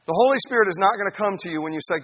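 ordinary delivery

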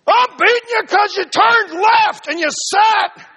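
high, loud, slow furious yell; enraged rider screaming; screaming at the donkey. Acted. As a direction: bellow at the stubborn beast. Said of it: "he's just screaming at the donkey".

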